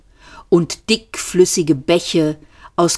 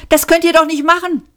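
high, fast raised protest; crying out; 12 semitones higher, 3 dB louder, faster than usual. A narrator's reading. Trillian pleading with the police, called out. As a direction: call it out quickly. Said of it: "rief Trillian".

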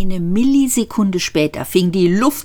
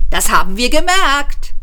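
calling out; 10 semitones higher, 4 dB louder than usual. A narrator's reading. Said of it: "rief Ford".

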